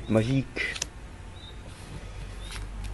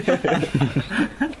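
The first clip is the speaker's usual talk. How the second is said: voix bizarre, aiguë et très lente, en riant; déformer la voix en traînant; voix changée; 6 semitones higher, much slower than usual. Pretend. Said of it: "j'ai changé de voix". (voix trafiquée en riant)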